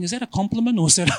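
quick, bemused aside to himself; wondering aloud, half amused; puzzled inner thought. Retold or imitated. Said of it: "I'm thinking".

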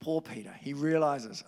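usual speaking voice

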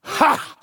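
one short, high, loud bark of a laugh. Acted. King Saul scoffing at young David, barked laugh. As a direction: spit out a scornful single laugh. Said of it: "Saul barked".

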